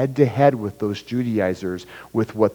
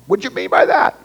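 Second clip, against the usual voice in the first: higher, louder, indignant mock challenge; offended retort; 9 semitones higher, 6 dB louder than usual. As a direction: play someone taking a text the wrong way. Acted text message quarrel.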